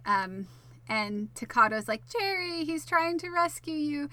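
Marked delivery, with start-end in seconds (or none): 2.1-4.1 imitated calling out. Takado calling to trapped Jerry, imitated high call. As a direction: call out high, as if to someone far away.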